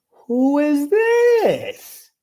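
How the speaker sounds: high, slow, drawn-out question; shrill demand. Retold or imitated. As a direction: stretch it way up high. Demanding who is calling.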